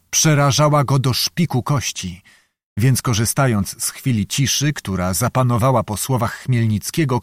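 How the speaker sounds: ordinary delivery, nothing marked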